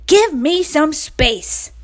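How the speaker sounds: slow, high bossy order; commanding rooster. A narrator's reading. Royal pushing the hens away, slow bossy demand. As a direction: demand it slowly. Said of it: "commanded Royal".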